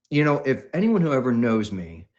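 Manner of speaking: normal talking voice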